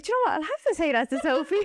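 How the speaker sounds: high, bubbly eager retelling; bursting to share the news with a friend; excited to tell. Retold or imitated. Excited thought about telling Sophie.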